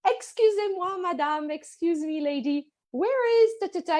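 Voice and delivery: ex-husband charmingly asking the baker for directions. Retold or imitated. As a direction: charm her with a lifted sweet voice. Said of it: "he's very charming".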